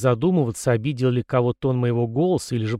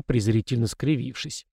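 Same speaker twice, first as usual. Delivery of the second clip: ordinary delivery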